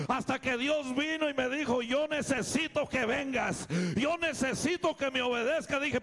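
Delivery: loud, high, urgent preaching; preaching at full force. Acted. Pastor preaching his testimony to the congregation, loud and urgent. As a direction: preach it out to the church, rising.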